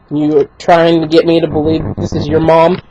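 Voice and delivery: low, quiet mimicry of a doubting clerk; skeptical look voiced. Bagger doubting the mom's I D, low skeptical mimicry. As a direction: drop the pitch and mock his disbelief. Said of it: "just gives me the look".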